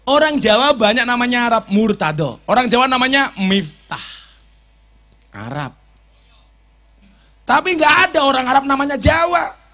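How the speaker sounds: loud, high sermon punchline; preaching at full force. Acted. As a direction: drive the point home to the crowd.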